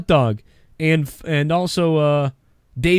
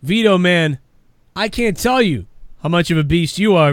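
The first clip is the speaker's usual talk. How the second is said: usual speaking voice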